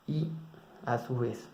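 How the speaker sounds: normal talking voice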